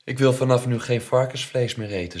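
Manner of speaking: high, very loud refusal; defiant announcement. A narrator's reading. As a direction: declare it stubbornly to mother. Child defies mother at table. (luid en koppig weigeren)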